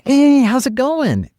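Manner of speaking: high, slow, bright hello; enthusiastic greeting. Retold or imitated. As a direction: greet them full of energy. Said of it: "And that type of enthusiasm".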